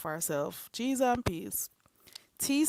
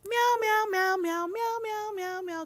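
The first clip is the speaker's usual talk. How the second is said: high meows getting softer; cat meowing; 8 semitones higher, 6 dB quieter than usual. Pretend. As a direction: meow like a cat, fading.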